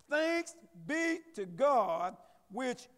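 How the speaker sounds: loud, slow, high-pitched sermon call; preaching. Real, not acted. Preacher driving home the verse to the congregation, preaching loudly. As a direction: preach it hard, ringing out the questions.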